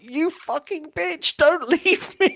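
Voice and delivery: very high, drawn-out comic wail; mock desperate plea. Captive to departing rescuer, hammed-up panicked protest. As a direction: imitate an outraged captive, pitched right up.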